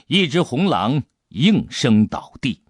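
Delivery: ordinary delivery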